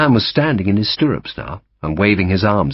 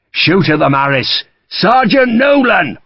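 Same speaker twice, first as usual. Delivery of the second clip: loud, high commands; barked orders; 11 semitones higher, 4 dB louder than usual. A narrator's reading. Sharp orders his men to fire, loud and high. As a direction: shout them over the gunfire.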